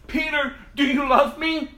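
loud, high, insistent question; urgent demand. Acted. Jesus asking Peter a third time, urgent and forceful.

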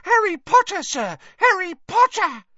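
very high, loud elf squeal; tiny creature's excited squeal. A narrator's reading. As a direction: squeal the name in overjoyed greeting.

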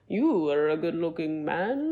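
slow, drawn-out mock come-on; weird creepy flirt. Party guest flirting with a friend's husband, mock creepy. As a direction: imitate a creep sizing someone up, slowly. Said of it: "like, being weird".

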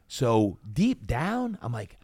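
ordinary talk

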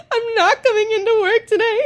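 high, wailing half-laughed sob; mock sobbing. Retelling calling her manager, hammed-up crying wail. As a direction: blubber it through fake tears. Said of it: "I'm sobbing".

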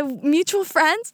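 high, drawn-out sheepish stalling; making up excuses. Retold or imitated. Answering how she found his Instagram, making excuses. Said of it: "making up excuses".

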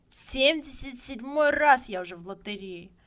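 put-on the old man raising his voice; old man insisting. A narrator's reading. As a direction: say it again with pride. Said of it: "говорил он".

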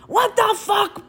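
high, shrieking "What the fuck?" in big letters; mock shriek. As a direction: squeal it out like a shocked text in capitals. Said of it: "big letters".

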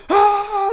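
high loud hammed-up ah; mock scream. Zach screaming while the coach watches. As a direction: scream like a madman. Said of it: "like, screaming".

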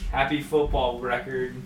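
usual speaking voice

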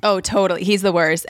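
usual speaking voice

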